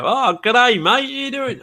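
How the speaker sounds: high, bouncy mock Australian greeting; mock Australian accent. Teasing how Canadians pictured her, mock Australian accent. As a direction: send up a cheery Australian accent, pitched high. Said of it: "They thought I was like".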